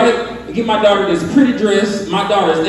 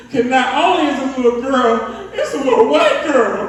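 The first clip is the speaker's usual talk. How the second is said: ordinary talk, nothing marked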